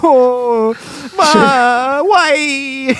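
high mock-anguished wail; Paolo. Mimicking a betrayed family member, hammed-up anguish. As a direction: overact a drawn-out, despairing cry. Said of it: "by the bald wig and just like".

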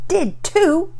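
put-on high childish retort; snapped. A narrator's reading. Sister contradicting her brother, snapping. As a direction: snap back in a squabbling kid's high voice. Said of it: "Penelope snapped".

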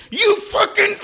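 very high shouted fury; imitating an eruption like a volcano; hammed-up screaming outburst; mock screaming rage. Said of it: "He goes".

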